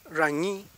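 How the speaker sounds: RAÑÍ with an untrilled first r; Mapuche initial r. Shown as a demonstration. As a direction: begin RAÑÍ with an English-like r. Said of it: "Esa es la forma de pronunciar".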